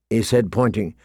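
usual speaking voice

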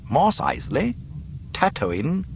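high, rising questions; puzzled. A narrator's reading. Tarkin puzzled by the report, questioning rise. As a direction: query each name, lifting the end. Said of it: "Tarkin looked puzzled".

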